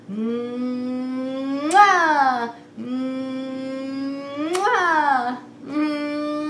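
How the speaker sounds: drawn-out blown kiss; playful mwah; kiss sound. Acted. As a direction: blow a big kiss to the kids. Puppet blowing kisses.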